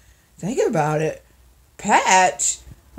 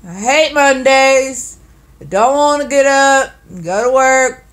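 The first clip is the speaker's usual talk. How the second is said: whiny complaint; 5 semitones higher, 5 dB louder than usual. Real, not acted. Complaining about Mondays.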